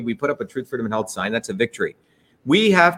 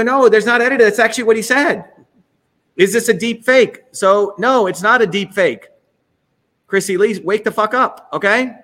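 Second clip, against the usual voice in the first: loud, higher, exasperated ranting; angry outburst; 5 semitones higher, 4 dB louder than usual. Real, not acted. Host yelling at a follower to wake up, loud and exasperated. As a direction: shout them awake, fed up.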